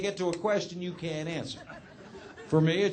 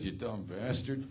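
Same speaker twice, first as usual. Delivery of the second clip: much softer and lower, slow; muttered insult; 9 semitones lower, 11 dB quieter, slower than usual. Retold or imitated. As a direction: say it low so he can't hear. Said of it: "under my breath".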